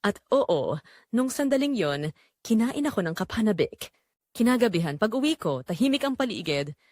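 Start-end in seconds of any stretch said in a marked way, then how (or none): none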